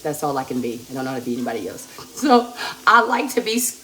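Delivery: normal talking voice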